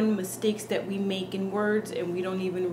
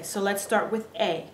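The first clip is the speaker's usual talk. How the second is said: ordinary delivery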